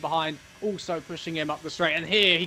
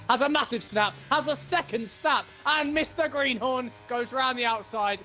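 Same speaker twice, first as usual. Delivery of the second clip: excited commentary; 8 semitones higher than usual. Real, not acted. Live race commentary, car snaps.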